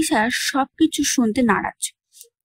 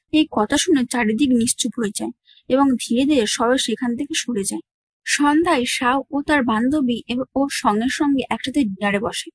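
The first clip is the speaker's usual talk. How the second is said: ordinary delivery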